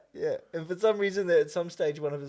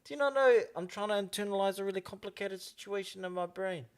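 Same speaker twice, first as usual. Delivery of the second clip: put-on higher-pitched rural Māori accent; 9 semitones higher than usual. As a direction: imitate an ad character's rural Māori New Zealand accent. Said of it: "the way I was talking right then".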